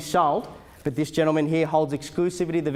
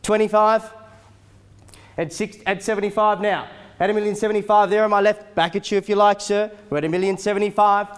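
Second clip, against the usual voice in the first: loud, rapid auctioneer calling; auctioneer calling bids; 5 semitones higher, 5 dB louder than usual. Acted. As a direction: rattle off the bids to the crowd. Live auction bidding calls.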